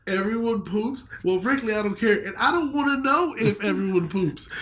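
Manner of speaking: mocking re-quote. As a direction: parody his indignant refusal to know. Re-quotes reviewer to mock his claim.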